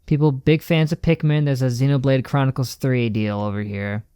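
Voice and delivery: normal talking voice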